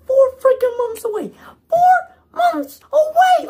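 very high, loud wailing shout; desperate cry. Real, not acted. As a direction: cry it out, stretched and pleading. Fan begging studio not to delay.

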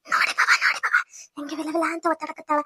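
hushed, gloating aside; secretive whisper. Acted. Sister-in-law gloats to him behind the door, whispering. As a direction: whisper it so she outside cannot hear.

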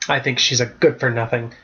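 ordinary delivery, nothing marked

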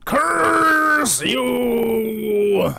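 high, long-held theatrical yell; mock anguished cry. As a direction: wail it like a hammed-up villain in defeat.

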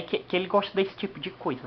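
ordinary talk

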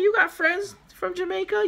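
high-pitched imitation of an excited boyfriend. Boyfriend reacting to her gifts from a friend, mock excited. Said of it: "He's gonna be excited".

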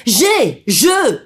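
high, loud preaching shout; fiery preaching. Acted. Preacher hammering the lesson on pride, full force. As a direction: thunder it from the pulpit.

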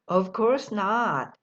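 very slow, drawn-out reply; slow model answer. Shown as a demonstration. Teacher modelling the answer very slowly. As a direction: stretch each word for learners. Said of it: "또는".

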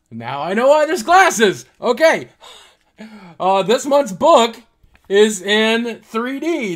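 delighted realization; excited. Real, not acted. Discovers 3D book.

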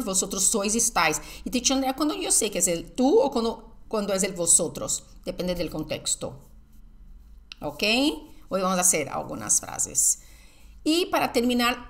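ordinary delivery

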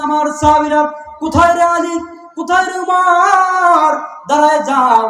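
high, loud, drawn-out summons; calling out, preached. Acted. Preacher acting the Prophet summoning Ali and Umar, loud high calling. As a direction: call the names across a crowd, stretching the last.

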